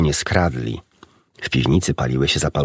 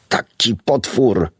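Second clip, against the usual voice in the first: slow, higher indignant cry; shouting; 5 semitones higher, slower than usual. A narrator's reading. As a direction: spit out the word monster slowly. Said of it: "krzyczał Bumpo". (powolny, oburzony okrzyk)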